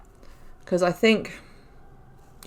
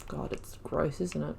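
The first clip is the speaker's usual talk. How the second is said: disgusted whisper; 3 semitones lower, 11 dB quieter than usual. Real, not acted. Disgusted at the game visuals.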